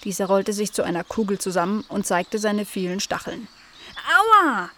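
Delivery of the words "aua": high, loud little yelp; pained cry. A narrator's reading. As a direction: cry out at a sudden prick. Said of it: "schrie Cleo". (hoher Schmerzensschrei)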